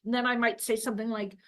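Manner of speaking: usual speaking voice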